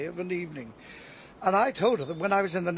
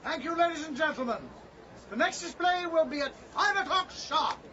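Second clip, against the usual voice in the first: public announcement; 8 semitones higher, 3 dB quieter than usual. Acted.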